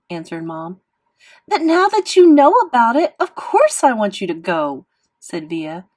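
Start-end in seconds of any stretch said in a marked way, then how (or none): 1.5-4.8 loud protest starting high; heated arguing. A narrator's reading. Sister arguing with her mother, heated. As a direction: argue back with raised voice.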